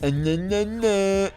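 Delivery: tipsy squeaky high no no no. Real, not acted. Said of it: "Sorry, I'm kinda tipsy".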